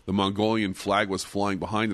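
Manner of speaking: ordinary talk, nothing marked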